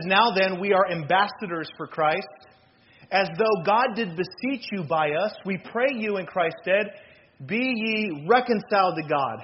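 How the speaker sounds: loud, measured, emphatic preacher's reading; forceful scripture reading. Real, not acted. Preacher reading Corinthians to the congregation, loud and emphatic. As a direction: proclaim the verse with weight.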